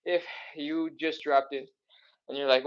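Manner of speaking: ordinary talk, nothing marked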